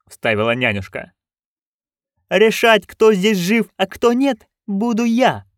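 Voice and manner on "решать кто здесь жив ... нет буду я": high, loud haughty declaration; imperious duchess. Acted. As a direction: lay down the law as the duchess. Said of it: "заявила герцогиня".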